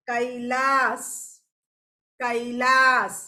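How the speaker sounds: normal talking voice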